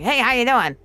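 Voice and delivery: brash impression of an obnoxious talking egg; obnoxious fifties voice. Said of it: "stereotypical nineteen fifties kinda like".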